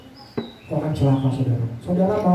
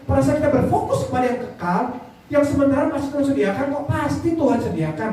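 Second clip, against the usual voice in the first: high-pitched, driving sermon delivery; fervent preaching; 10 semitones higher than usual. Real, not acted. Preacher urging congregation toward eternal life, fervent preaching.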